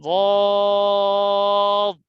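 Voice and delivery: ওয়াদ held out long and loud, tongue side pressed; long drawn dwad. Shown as a demonstration. Teacher models ওয়াদ for students, drawn out and loud. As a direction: stretch ওয়াদ slowly, pressing the heavy d. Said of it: "এরকম".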